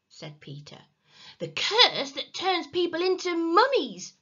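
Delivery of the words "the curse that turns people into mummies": high, loud, urgent invention; desperate. A narrator's reading. Henry trying to stop Peter telling, desperate. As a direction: grasp at a scary story. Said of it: "said Henry desperately".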